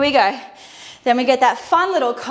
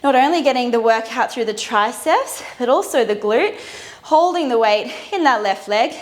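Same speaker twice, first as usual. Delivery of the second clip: ordinary talk, nothing marked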